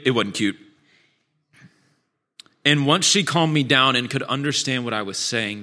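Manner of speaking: ordinary talk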